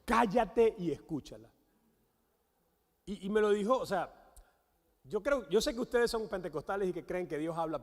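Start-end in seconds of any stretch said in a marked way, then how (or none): 0.0-1.5 firm, punched-out order; forceful command. Retold or imitated. Holy Spirit ordering the husband mid-argument, forceful command. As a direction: quote a blunt, commanding voice. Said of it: "en esa contundencia".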